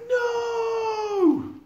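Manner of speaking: very high, loud scream; crying out. Acted. Both crying out as they head for a crash. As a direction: scream it with terror. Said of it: "Together they cried".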